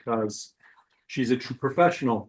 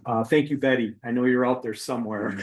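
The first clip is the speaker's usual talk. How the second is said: normal talking voice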